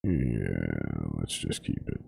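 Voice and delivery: low, soft resigned mutter; mumbled resignation; defeated mumble. Real, not acted. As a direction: give up under your breath.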